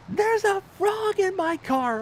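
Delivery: hammed-up frightened wife. Wife explains panic, retold.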